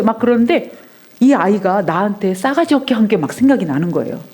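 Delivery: ordinary delivery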